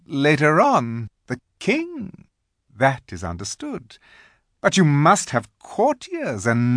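lifted, emphatic queenly voice; grand coaxing Queen. A narrator's reading. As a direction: dangle titles like a grand queen. Queen persuades Edmund.

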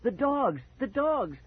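frantic alarm, repeated; terrified. Acted. Boy clutching Dad cries out about the dogs.

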